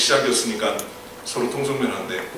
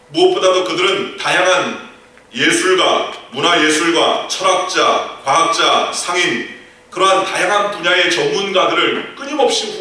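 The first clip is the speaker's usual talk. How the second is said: louder preaching, reading the verse out with weight; full-force preaching; 3 semitones higher, 3 dB louder than usual. Acted. Pastor reading Philippians aloud to the congregation, preaching at full force. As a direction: proclaim the scripture to the whole congregation. Preaching about the Medici effect.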